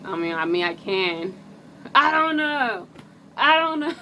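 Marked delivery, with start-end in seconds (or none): 1.9-3.9 much higher, louder playful squeak; squeaky high don't-know. Acted. Shrugging off the viewer's question in a high squeak. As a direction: chirp it up high like a cartoon.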